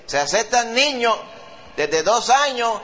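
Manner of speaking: high, slow, drawn-out sign-reading voice; amusement park announcement. Retold or imitated. Preacher voices a theme park sign, high and slow. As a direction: sound like a cheery park announcer. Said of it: "que dice".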